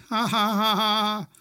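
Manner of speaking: high, loud spelled-out ha ha; gloating storybook laugh; mock triumphant laughter; spoken laugh. A narrator's reading. Girl gloats after escaping.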